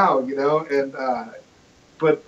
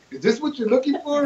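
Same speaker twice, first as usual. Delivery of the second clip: high mock innocent voice; 9 semitones higher, 4 dB quieter than usual. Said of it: "and he's all".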